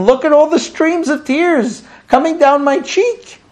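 mock tearful complaint. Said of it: "she's telling him".

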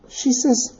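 usual speaking voice

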